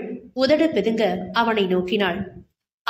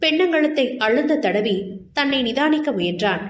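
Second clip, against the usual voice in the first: ordinary delivery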